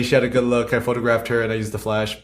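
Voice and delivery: ordinary delivery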